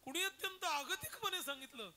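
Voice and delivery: high, loud bewildered cry; shocked cry. Retold or imitated. Husband crying out to his wife, loud and high. Husband shaken, asks who stopped them.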